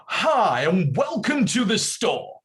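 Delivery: loud, high, drawn-out tannoy patter; store announcer. Retold or imitated. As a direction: hype the bargain over the shop microphone. Acts out his old store announcements.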